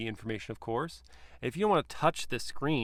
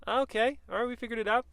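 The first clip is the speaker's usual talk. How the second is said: much higher, bright triumph; a happy squeak of success; pleased relief; 11 semitones higher, faster than usual. Real, not acted. Reviewer delighted after solving the cup holders.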